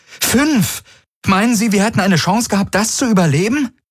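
hoch, FÜNF schrill betont; bestimmt, mit verdeckter Furcht; bestimmt, selbstsicher. A narrator's reading. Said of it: "Sie klang bestimmt, selbstsicher". (Kirai, hoch und bestimmt, Angst überspielend)

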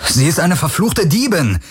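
high, heated accusation; indignant high-pitched outburst; snorted indignation. A narrator's reading. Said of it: "schnaubte Garland".

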